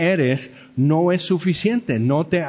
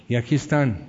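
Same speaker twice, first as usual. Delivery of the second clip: usual speaking voice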